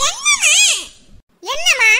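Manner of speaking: loud, very high answering yell; shouting back. Acted. Son answering his mother's repeated calls, shouting back. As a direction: yell back with a falling end.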